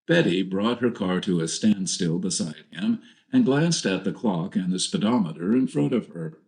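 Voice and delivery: ordinary delivery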